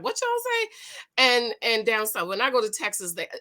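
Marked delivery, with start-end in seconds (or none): 0.0-0.7 high, mock-confused Midwest listener; puzzled Midwesterner. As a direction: imitate someone who can't follow a New York accent. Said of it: "people in the Midwest is like".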